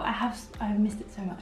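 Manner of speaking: breathy whispered confession; whispering. Real, not acted. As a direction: whisper it close to the mic. Whispered aside to viewers.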